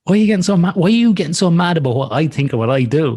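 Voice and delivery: high-pitched incredulous retort; acted exasperation. Acted retort to angry people.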